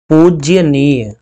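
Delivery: पूजनीय spoken very slowly, each syllable apart; teacher stretching पूजनीय; slow spelling demo. Shown as a demonstration. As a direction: dictate पूजनीय slowly to the students. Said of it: "हम लोग ऐसे लिखते हैं पूजनीय".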